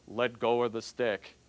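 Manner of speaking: slow, lightly voiced divine command; voice of God. God tells the dangling man to let go, slow and low. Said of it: "So God says".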